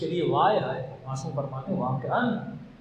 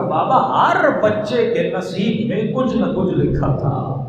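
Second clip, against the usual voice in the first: mournful majlis chant, louder; loud lament recitation; 6 dB louder than usual. Acted. Preacher recounting Sakina's question to the crowd, loud mournful lament. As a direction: wail the tale out to the mourners.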